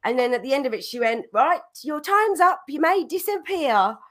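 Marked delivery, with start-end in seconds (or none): 1.3-4.0 high, imitated lady piano teacher; cockney teacher. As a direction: dismiss the pupil in a high cockney lady's voice. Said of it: "she was real cockney".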